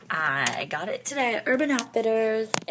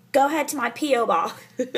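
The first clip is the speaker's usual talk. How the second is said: normal talking voice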